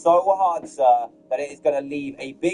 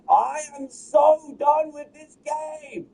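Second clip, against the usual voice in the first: high, slow exasperated whine; 10 semitones higher, slower than usual. Real, not acted. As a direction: drag it out, fed up and pitched up. Exasperated outburst.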